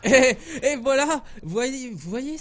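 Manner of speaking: high, loud caster exclamation; excited call. Real, not acted. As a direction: burst out with the call.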